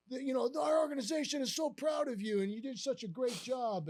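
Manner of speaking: imitated excited praise, pitched high; Jim Campbell. Boss praising his manager after the no-hitter, excited. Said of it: "as excited as I've ever heard him".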